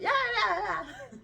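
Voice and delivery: sudden very high-pitched yeahs; crazy outburst. Shown as a demonstration. Mocking her own swings from real flat, crazy high outburst. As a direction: leap way up high, mock-manic. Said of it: "I feel so crazy 'cause I'll be".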